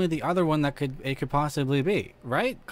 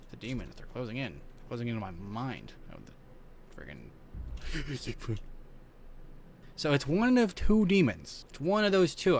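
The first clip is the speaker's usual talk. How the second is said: normal talking voice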